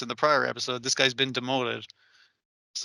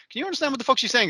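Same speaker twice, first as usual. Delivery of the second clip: mock panicked student; 10 semitones higher, much faster than usual. Student whispering during the test.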